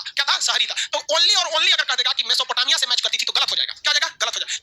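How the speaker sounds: usual speaking voice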